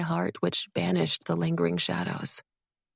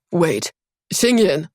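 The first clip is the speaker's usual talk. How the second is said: loud, slow call cracking with despair; 7 dB louder, slower than usual. Acted. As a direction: call her back before she leaves. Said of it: "His tone was cracked by a note of despair".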